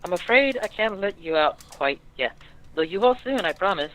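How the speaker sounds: put-on a playful maid girl promising mischief; sly girl. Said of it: "and she says".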